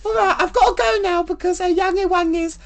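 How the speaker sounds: high put-on woman's voice; Wendy character voice. Wendy quizzing Alex about his IBS in high put-on voice.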